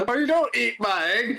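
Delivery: high mock protest, half laughing; protective yelp. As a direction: squeal it out in mock alarm. Protective outburst at table.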